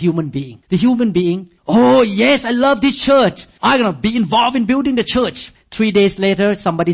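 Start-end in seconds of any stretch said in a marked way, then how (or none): 1.7-5.5 mock eager churchgoer. Mimics eager new churchgoer.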